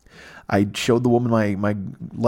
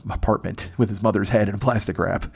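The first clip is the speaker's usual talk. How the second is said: normal talking voice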